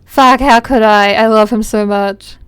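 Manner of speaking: gushing. Real, not acted. Doting on a beloved plushie, slow loud gushing. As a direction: gush over it slowly and fondly.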